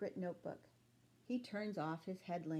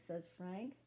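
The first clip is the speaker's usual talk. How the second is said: usual speaking voice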